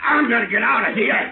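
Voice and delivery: high frightened shout; panicked shout. Acted. Drugged victim panics and tries to flee, shouting. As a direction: yell it as panic takes hold.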